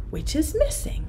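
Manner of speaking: hushed secret question; whisper. Shown as a demonstration. Teacher whispering the riddle to the children. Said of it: "whispered question".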